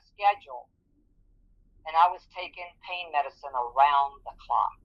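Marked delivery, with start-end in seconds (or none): none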